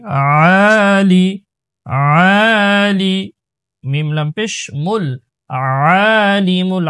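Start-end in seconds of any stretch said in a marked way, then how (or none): 0.0-3.3 আলি very slow, the first aa drawn out; one-alif madd. Shown as a demonstration. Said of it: "এক আলিফ মাদ". 5.5-6.9 আলিমুল opening with ayn squeezed in the throat; throat-pressed ayn. Shown as a demonstration. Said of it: "আইনের উচ্চারণটা গলায় চাপ দিব".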